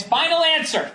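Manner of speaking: high put-on final answer; playful falsetto catchphrase; mock game show. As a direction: say it like a quiz contestant.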